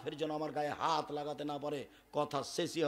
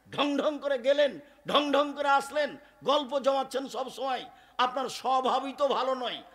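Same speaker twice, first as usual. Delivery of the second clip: scolding; 8 semitones higher, 7 dB louder than usual. Acted. Wife scolding her husband.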